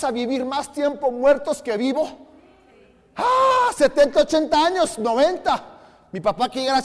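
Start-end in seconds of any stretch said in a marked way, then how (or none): 3.2-3.7 sudden high shout of realization. Real, not acted. Pastor exclaiming to congregation, sudden loud ah. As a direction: startle the crowd with a high cry.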